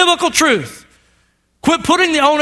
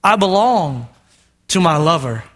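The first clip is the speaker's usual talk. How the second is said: tender scripture reading; 7 semitones lower, slower than usual. Acted. Pastor reads the bride's reply from Song of Songs, low and tender. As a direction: read it like a love poem.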